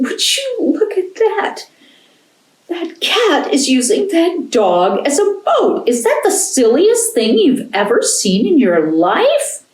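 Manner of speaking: put-on high jeering kid voices; mocking boys. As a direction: laugh at them from the shore, up high. Boys on shore mock them.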